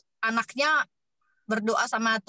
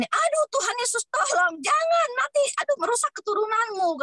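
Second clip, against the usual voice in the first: high, mock-panicked plea to Jesus; hammed-up worried mother crying out to God; imitating a fretful mother; 12 semitones higher than usual. Retelling the pastor's wife begging God over her daughter's choice, high mock panic. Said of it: "Terus mamanya bilang gini".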